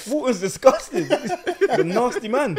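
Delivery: laughing, fake outrage; mock disgust. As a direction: pretend to be appalled while giggling.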